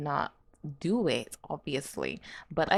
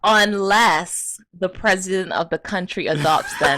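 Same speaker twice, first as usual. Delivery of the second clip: laughing; 8 dB louder than usual. Real, not acted. Reading absurd fact, laughing.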